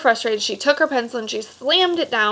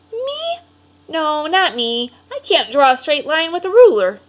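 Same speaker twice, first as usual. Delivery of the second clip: put-on shy boy; 7 semitones higher, 5 dB louder than usual. Boy doubts himself.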